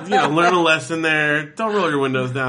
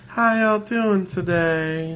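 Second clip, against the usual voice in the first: slow, sweet Cajun lady voice, pitched up; sweet Southern drawl; 6 semitones higher, much slower than usual. Retold or imitated. Mimicking the slow Cajun cashier to a friend, sweet Southern drawl.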